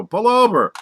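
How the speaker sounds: high, loud yell out the window; screamed order. Acted. Cop screaming at the blonde driver out the window. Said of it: "screams out the window".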